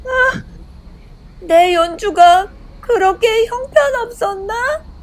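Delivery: high, slow crying wail; tearful dismay. A narrator's reading. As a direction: whimper it slowly, hurt and in tears. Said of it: "엠마 역시 눈물을 흘리며".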